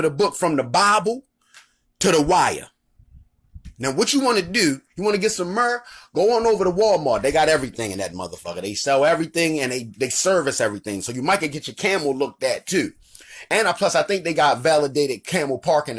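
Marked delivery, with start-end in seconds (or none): none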